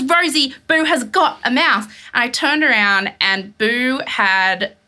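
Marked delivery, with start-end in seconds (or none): 0.0-1.8 higher-pitched urgent announcement; imitated urgent partner. Partner alerts her to the cat's catch, urgent. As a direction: mimic someone insisting on alarming news. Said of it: "He goes".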